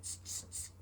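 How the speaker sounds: unvoiced teasing tss; monkeys' tss. Monkeys mocking the peddler with a whispered tss. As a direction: hiss tss back like cheeky monkeys.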